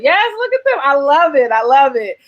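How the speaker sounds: high, delighted gush; excited. Real, not acted. Host delighted by guests on screen, excited. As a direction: beam it out with joy.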